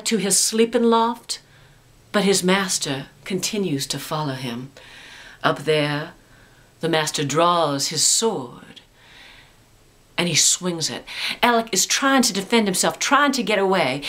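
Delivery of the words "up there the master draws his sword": ordinary delivery, nothing marked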